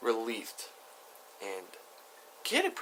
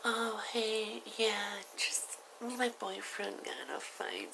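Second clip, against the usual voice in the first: high, soft put-on woman's voice; voiced older woman; 12 semitones higher, 7 dB quieter, slower than usual. Woman explaining boyfriend fight to strangers, high put-on voice. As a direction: play her high and softly.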